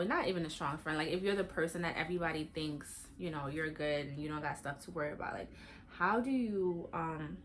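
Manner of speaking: usual speaking voice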